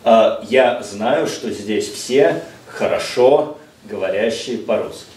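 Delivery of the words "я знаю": usual speaking voice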